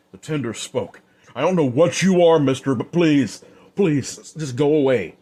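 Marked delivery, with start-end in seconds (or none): 1.4-5.2 higher and louder, begging; scared begging bartender; frightened pleading. Acted. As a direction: plead with the stranger to go. Bartender begs the killer to leave.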